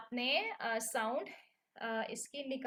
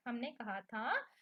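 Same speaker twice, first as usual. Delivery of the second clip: normal talking voice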